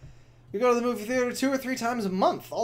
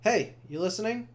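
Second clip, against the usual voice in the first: ordinary delivery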